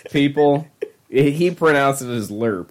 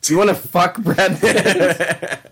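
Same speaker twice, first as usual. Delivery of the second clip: slow, higher hammed-up putdown; mock grouchy coworker; 4 semitones higher, slower than usual. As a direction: drag out the insult like a grumpy old man. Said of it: "he's like".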